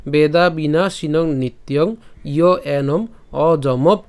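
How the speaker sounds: slow word-by-word Sanskrit reading; verse recitation demo. Shown as a demonstration. Teacher says it from the start, slow recitation. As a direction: recite measuredly with small gaps between words. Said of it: "আবার যদি প্রথম থেকে বলি".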